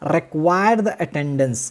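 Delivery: Require the attendance stretched out very slowly; slow dictation. Shown as a demonstration. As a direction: spell out Require the attendance word by word. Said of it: "क्या कर सकता है?".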